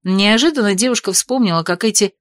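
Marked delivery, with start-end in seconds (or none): none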